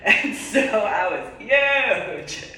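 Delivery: laughing. Real, not acted. Stresses her size, laughing.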